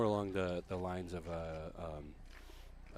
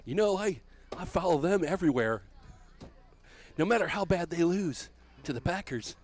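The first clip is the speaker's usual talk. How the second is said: high, loud preacher voice, wagging a finger; preacher defends the Bears to John, high and loud; preacher scolding; 9 semitones higher, 6 dB louder than usual. Acted. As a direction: warn John not to talk bad about the Bears. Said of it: "That's pretty good".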